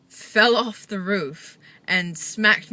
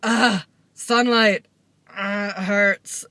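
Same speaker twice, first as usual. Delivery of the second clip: pained groan; 6 dB louder, slower than usual. Retold or imitated. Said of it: "you're just like".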